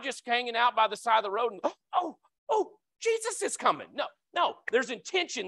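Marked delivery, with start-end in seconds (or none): 1.6-3.9 high, light mock surprise at Jesus passing; a gasp and a squeaky "oh, Jesus is coming"; mock surprised gasp. Preacher mocking idle bystanders, high surprised gasp. Mock casual lepers by roadside.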